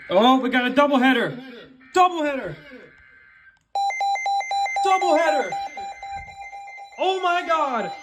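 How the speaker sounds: ordinary talk, nothing marked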